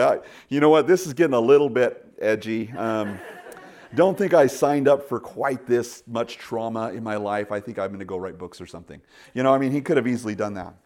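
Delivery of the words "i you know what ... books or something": loud, half-laughing mock complaint; a lightly voiced fed-up apostle; imagined weary Paul. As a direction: play Paul ready to quit. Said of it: "He could have just gone home to mommy".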